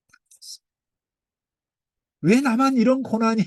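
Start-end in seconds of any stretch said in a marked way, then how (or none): 0.1-0.7 breathy hushed whisper. Real, not acted. Preacher hushing the congregation, whispering. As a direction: drop to a whisper for the reveal. Awed reveal of God's suffering.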